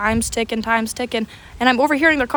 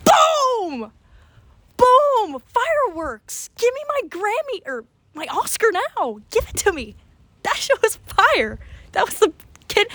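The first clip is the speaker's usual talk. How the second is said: high-pitched hammed-up celebration; mock triumph; 10 semitones higher than usual. Student hyping up their own video, mock triumph. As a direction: crow with over-the-top self-congratulation.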